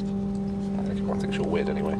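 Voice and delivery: teasing whisper. Real, not acted. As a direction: whisper a cheeky jab. Whispering on a quiet train.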